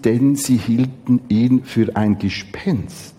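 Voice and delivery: tiefer und etwas leiser vorgelesen; ruhig, feierlich lesen; Bibellesung. Acted. Lesung geht weiter. (gemessene Schriftlesung)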